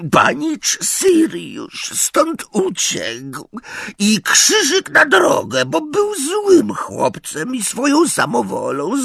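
rock and wail through the memory; keening house-elf. A narrator's reading. Elf's long story of Regulus.

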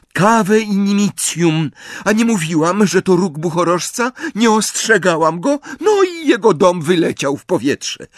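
high, loud told-you-so rant; agitated scolding. Acted. Hermione casting spell, scolding after escape. (wzburzone, głośne wyrzuty)

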